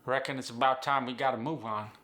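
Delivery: Appalachian long I as "ah" in "time"; drawn-open "tahm" in an Appalachian accent. Acted. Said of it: "becomes an ah sound".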